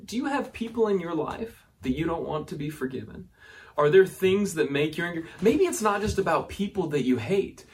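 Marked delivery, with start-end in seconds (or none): none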